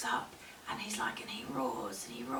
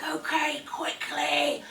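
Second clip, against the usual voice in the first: loud, higher hurried consent; urgent bear; 8 semitones higher, 8 dB louder, slower than usual. Acted. Bear to the small scared woman out of pity, urgent.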